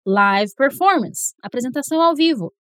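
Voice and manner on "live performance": live in live performance with a long i; live rhymes with five here. Shown as a demonstration. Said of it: "eu posso dizer live performance".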